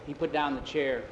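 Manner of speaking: plain reading voice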